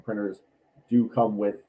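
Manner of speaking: ordinary talk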